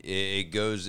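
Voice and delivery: normal talking voice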